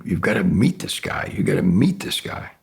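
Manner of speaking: urgent whispered insistence. Retold or imitated. Coach passing on a friend's urgent tip in a whisper. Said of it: "he kept saying".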